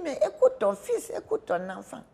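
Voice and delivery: high, loud burst on the first phrase; exasperated guard to the mother, loud. Retold or imitated. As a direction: throw up your hands, listen to your son. Guard exasperated at her questions.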